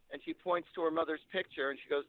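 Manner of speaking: ordinary delivery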